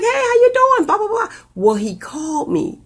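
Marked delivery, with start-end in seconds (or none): 0.0-1.3 high-pitched imitation of small talk; re-enacted greeting, pitched way up; chatty mock greeting. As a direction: put on a high, breezy hello. Re-enacts her chatty store greeting.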